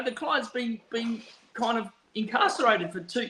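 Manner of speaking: ordinary delivery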